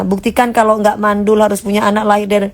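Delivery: ordinary delivery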